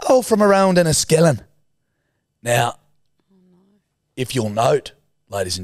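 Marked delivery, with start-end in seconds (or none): 0.1-1.4 higher, louder mock Irish; fake Irish accent. Teen names his Irish hometown in mock accent. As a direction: claim Enniskillen in a put-on Irish accent. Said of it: "So I said".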